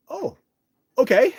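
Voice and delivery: sudden high, loud "okay"; startled surprise. Real, not acted. Stunned by the mentor's praise, high startled okay. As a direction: caught completely off guard.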